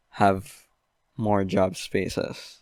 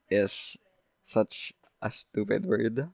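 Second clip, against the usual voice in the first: usual speaking voice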